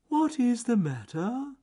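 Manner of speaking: slow, high, loud question; John Slow Tortoise. Acted. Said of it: "John Slow Tortoise said".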